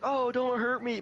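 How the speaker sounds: high-pitched fake victim plea; mock pleading. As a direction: imitate a helpless victim, half joking. Mimics bully pretending to be victim.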